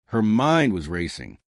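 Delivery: plain reading voice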